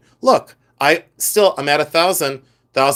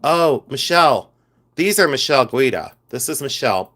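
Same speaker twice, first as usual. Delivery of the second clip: ordinary delivery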